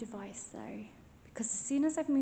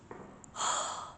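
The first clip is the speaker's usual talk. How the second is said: breath huff; 5 dB quieter than usual. Shown as a demonstration. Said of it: "that's, that's my, um, breath".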